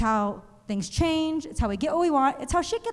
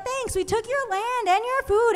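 higher, lightly voiced sarcastic slogan; mock rebrand; 10 semitones higher than usual. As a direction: sell it like a cheesy slogan. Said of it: "It's like".

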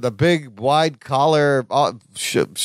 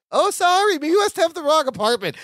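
much higher imitated voice; raised, put-on voice of an apologetic visitor; Marianne; 10 semitones higher than usual. Co-host imitates woman outside the door, high apologetic voice. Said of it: "this time you hear Marianne going".

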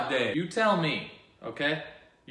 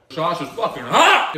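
louder sudden yelp at the heat; hot sauce shout; 2 semitones higher, 10 dB louder than usual. Real, not acted. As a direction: blurt it out, burned. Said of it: "reacting to hot sauce".

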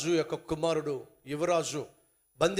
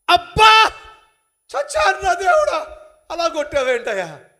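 high, loud cry of pain; high, loud howl of pain, fading into a complaint; pained yell; 10 semitones higher, 12 dB louder than usual. Acted. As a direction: yell as the stone hits your head. Man hit by stone cries.

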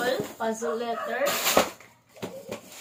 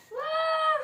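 put-on high, drawn-out wow for the baby; exaggerated wow. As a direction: sell the surprise to the toddler. Mock amazement for toddler.

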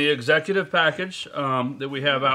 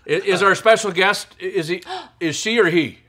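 excited; 7 semitones higher, 6 dB louder than usual. Real, not acted. Excited about the special guest.

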